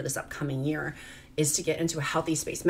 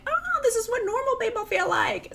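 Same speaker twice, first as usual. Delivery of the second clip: much higher, wide-eyed wonder; amazed inner voice. Retold or imitated. Mom quoting her own amazement on the plane, pitched up high. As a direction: let it float up in disbelief.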